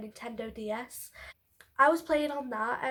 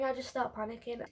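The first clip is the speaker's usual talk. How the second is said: ordinary delivery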